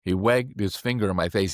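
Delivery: normal talking voice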